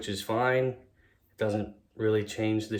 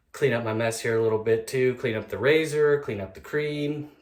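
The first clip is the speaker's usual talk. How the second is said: usual speaking voice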